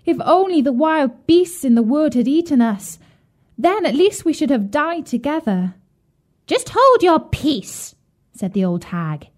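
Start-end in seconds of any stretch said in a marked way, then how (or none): 0.0-5.8 put-on high, lamenting little girl; crying out. A narrator's reading. Gretel despairs as she carries the water, high lamenting cry. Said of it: "she cried". 6.5-8.0 put-on high, slow, scornful old hag; scolding witch. A narrator's reading. Old hag silences weeping Gretel, high scolding witch.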